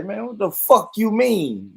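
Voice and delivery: drawn-out, high, in-your-face challenge; playful aggressive pushback; mock confrontation. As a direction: act mad-or-excited, hard to tell which. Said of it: "You don't know if we mad or we excited".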